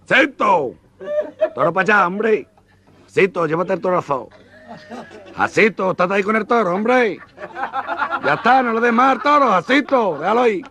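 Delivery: frantic orders to keep the bull away; panicked yelling. Acted. Matador yelling at Jacinto, high and frantic.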